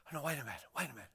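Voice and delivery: low, very soft aside; hushed interjection. Acted. Philip interrupting to ask about the Father, hushed. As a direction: cut in under your breath.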